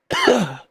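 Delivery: sharp real cough; hard cough. Real, not acted. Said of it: "Sorry for all the coughing".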